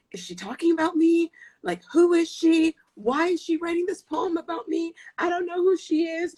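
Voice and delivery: high, agitated, incredulous questioning; retold losing it. Retelling her reaction to the poem, losing her mind. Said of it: "I was losing my mind".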